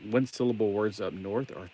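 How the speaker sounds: normal talking voice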